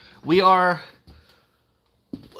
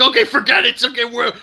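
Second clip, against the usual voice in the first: exasperated resolve; 7 semitones higher, 5 dB louder than usual. Retold or imitated. Retells reaction after election.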